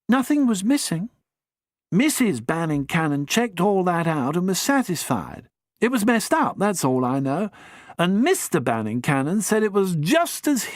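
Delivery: breezy gossip, lightly done; lightly voiced young chap.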